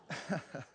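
short, soft chuckle; low suppressed laugh; real laugh. Real, not acted. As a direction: quietly chuckle at your own joke. Still laughing after the joke.

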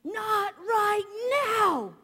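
guttural scream. Retold or imitated. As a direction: scream it from the gut, losing control. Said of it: "that guttural scream".

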